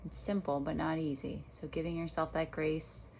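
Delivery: unhurried meditation guide with long pauses; guided meditation. Real, not acted. Meditation teacher guiding listeners back to their breath, guided meditation voice. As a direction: lead them gently through each breath, leaving space between phrases.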